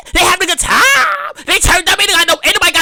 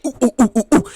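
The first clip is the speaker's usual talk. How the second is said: mock hooting; 2 semitones lower than usual. Mock hooting after question.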